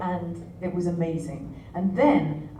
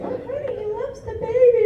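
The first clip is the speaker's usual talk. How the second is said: high, gushing sweetness; doting mother; 5 dB louder than usual. Retold or imitated. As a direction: gush like a proud mum. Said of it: "she's like".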